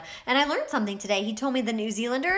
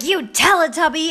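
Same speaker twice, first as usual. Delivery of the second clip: mock-angry jab, higher and louder; fight insult; 4 semitones higher, 6 dB louder than usual. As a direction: throw it like a playful insult. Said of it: "we were in a fight".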